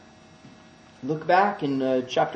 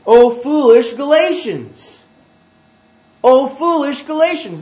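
loud, high, drawn-out scolding shout; stern rebuke; 8 semitones higher, 9 dB louder than usual. Acted. Paul rebuking the foolish Galatians, stern and loud. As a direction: cry it out slowly and forcefully in reproach. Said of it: "he might talk like this to some of you".

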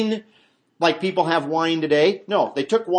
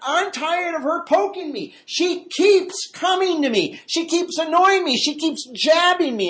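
higher, louder, put-upon griping; annoyed complaint; 12 semitones higher, 3 dB louder than usual. Retold or imitated. Preacher voicing a fed-up complainer, annoyed and raised. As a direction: whine it like she's had enough. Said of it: "He's talking about the fact".

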